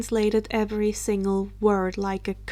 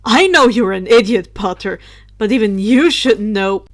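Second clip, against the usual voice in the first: scornful; 10 dB louder than usual. Acted. Drake scolds Potter.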